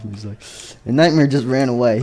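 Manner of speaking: plain reading voice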